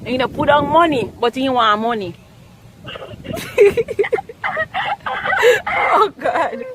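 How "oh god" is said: laughing. Real, not acted. Laughing at the prank.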